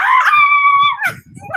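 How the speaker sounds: high shrieking laugh; real high laughter. Real, not acted. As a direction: burst out laughing. Cracking up.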